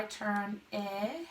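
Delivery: "turn, ip" in two separate parts, short i; sounded out. Shown as a demonstration. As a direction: sound out "turn, ip" slowly, softly. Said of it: "my I is a short vowel I".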